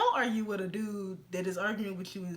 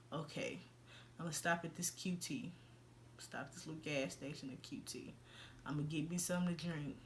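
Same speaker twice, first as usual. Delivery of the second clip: weary inner thought; 7 semitones lower, 12 dB quieter than usual. Retold or imitated. Tired inner thought at gas station.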